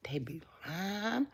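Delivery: hushed confiding whisper. Real, not acted. Sharing zodiac gossip with viewers, whispered. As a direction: lean in and whisper it.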